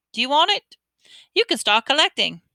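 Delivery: put-on a boy handing over a card; Ron's voice. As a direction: offer it up brightly. Ron offering card.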